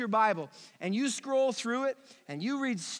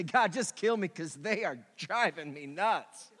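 lower, laughing through it; laughing mock plea; 6 semitones lower than usual. Pastor joking about his own flock, laughing. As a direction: joke that the congregation drives him nuts. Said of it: "you've never worn me out".

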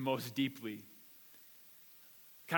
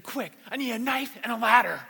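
high, loud reenacted urgency; frantic demand; 5 semitones higher, 8 dB louder than usual. Panicked dad at the neighbor's door, frantic shouted plea. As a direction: call out an emergency request at full tilt.

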